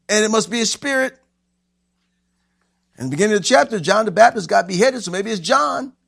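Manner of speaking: high, urgent pulpit delivery; animated preaching. Acted. Preacher picturing what the frightened disciples see, high urgent preaching.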